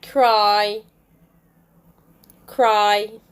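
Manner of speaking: "cry" with a held "eye" vowel; long I. Shown as a demonstration. Teacher gives example words, long I. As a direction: draw out the end of "cry". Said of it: "words with long I sound".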